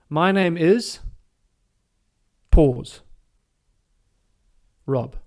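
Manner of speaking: "Rob" said after a long pause, firm and clear; pause before name, stress it. Shown as a demonstration. Caddy introducing himself to a golfer, pausing before a firm "Rob". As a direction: hold the beat, then land "Rob" with weight. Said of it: "you have emphasis on that word".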